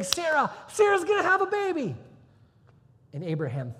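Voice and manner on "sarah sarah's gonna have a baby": very high, loud proclamation; excited announcement. Acted. God tells Abraham about Sarah's baby, excited announcement. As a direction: shout the big news out.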